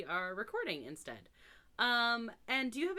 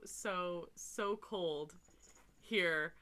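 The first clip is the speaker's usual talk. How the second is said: normal talking voice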